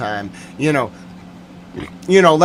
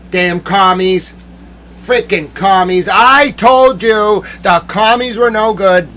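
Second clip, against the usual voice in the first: higher, indignant complaint rising louder; mad rant; 5 semitones higher, 4 dB louder than usual. Real, not acted. Ranting to listeners who never believed, mad. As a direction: build into an I-told-you-so outburst. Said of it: "I get so mad".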